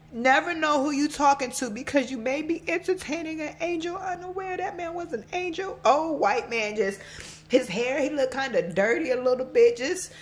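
high, emotional storytelling; fighting real tears while remembering someone; about to cry. Real, not acted. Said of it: "I'm about to cry".

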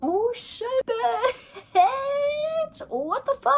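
very high, drawn-out squealing; ecstatic squeal. Retold or imitated. Reliving the kiss on the hilltop, ecstatic high squeal. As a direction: squeal every word in stunned delight. Acting out reaction to first kiss.

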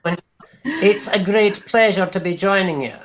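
a cheeky stage leprechaun; hammed-up Irish leprechaun greeting. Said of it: "I don't remember hiring the leprechaun".

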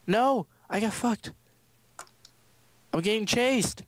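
frantic while chased; being chased. Real, not acted. Chased, calling for help.

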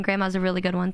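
ordinary talk

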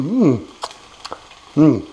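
ordinary delivery

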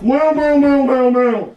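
very high, loud cartoon squeals; rat's shrieking meows. Shown as a demonstration. Director shows the rat's noise, shrieked. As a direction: shriek meows on one held high pitch. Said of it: "stick his head out and go".

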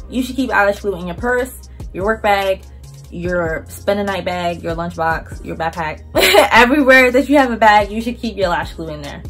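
usual speaking voice

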